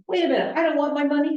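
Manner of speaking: imitating a board member objecting; mock complainer. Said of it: "They'll say".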